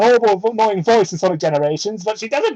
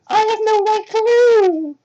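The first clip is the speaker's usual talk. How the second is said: high, slow put-on girl voice; mock Amy voice; 4 dB louder, slower than usual. Voicing Amy in a silly fan fiction, high squeak. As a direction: squeak out Amy's line.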